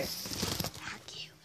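breathy whisper, barely audible; whispered insult. Real, not acted. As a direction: hiss it under your breath at the camera. Camera prank, whispered insult.

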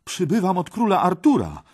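high, loud herald-like reply; bold young Parsival. Acted. Parsival answers the red knight, high and bold. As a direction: announce your errand boldly.

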